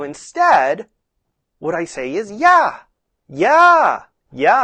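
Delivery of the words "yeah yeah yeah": high, loud, swooping yeah; bright, lifted yeah to reward the horse; bright praise tone. Shown as a demonstration. Rider rewarding a working horse, bright tone. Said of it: "bright tone".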